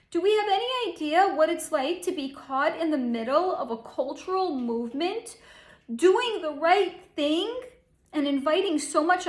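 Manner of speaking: a hint of a precious, wounded starlet; mocking Emma Watson. Psychic mocking the actress she is reading, in a pleading mock voice. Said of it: "I'm making fun of her".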